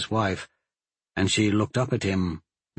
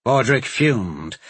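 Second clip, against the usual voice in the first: normal talking voice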